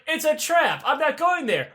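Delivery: high-pitched mock objection; put-on indignant refusal; mock protest. Acted refusal of an order.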